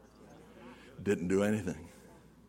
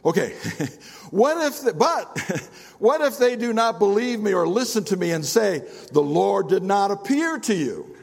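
nervous laughing excuse; 7 semitones higher than usual. Acted.

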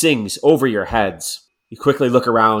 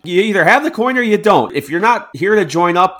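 higher, louder insistent demand; pressing; 8 semitones higher, 4 dB louder than usual. Acted. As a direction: corner him with the question. Soldier pressing stranger about coin.

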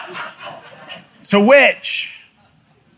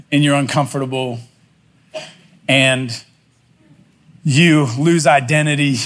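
reflective sermon close; 6 semitones lower than usual. Acted. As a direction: let the story land gently on the congregation. Closing reflection to the congregation.

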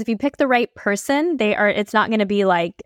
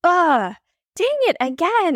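rising higher on each "oh" to a high "dang it"; exasperated realization; 8 semitones higher, slower than usual. Retold or imitated. Retelling how she caught herself being complacent, exasperated. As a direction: caught out again, mock groan. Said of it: "And I was like".

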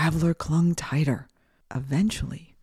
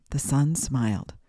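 ordinary delivery, nothing marked

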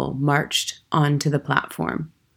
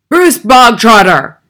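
sudden, slow, high-pitched roar of a name; Trunchbull's bark; 6 semitones higher, 13 dB louder, slower than usual. A narrator's reading. Headmistress summons a boy from assembly, barking. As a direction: bellow the name across the hall. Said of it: "the Trunchbull barked suddenly".

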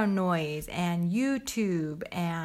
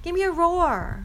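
loud playful coaxing; 10 semitones higher, 6 dB louder than usual. Real, not acted. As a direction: cheer the little one on to roar. Coaxing child to roar.

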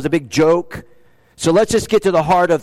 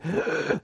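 pained gasp; 10 dB quieter than usual. Acted. Pained gasp while voicing Jesus.

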